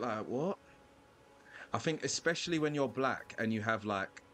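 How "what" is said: thrown. Retold or imitated. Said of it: "they're just a bit thrown".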